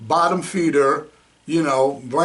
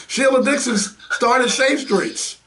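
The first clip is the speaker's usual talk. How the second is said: big gleeful delivery, pitched up; with gusto; 6 semitones higher than usual. Shown as a demonstration. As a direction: announce it with gusto, stretching the words. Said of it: "Say that with more gusto".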